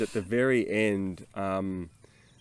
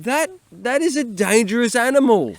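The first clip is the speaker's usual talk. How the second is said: high, loud alarmed voice; 9 semitones higher, 6 dB louder than usual. Retold or imitated. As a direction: sound startled by a huge goat. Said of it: "I was like".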